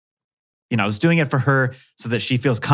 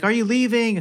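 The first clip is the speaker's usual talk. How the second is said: daughter's voice; 12 semitones higher than usual. Retold or imitated. Daughter voiced at school fence.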